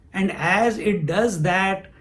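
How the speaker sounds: normal talking voice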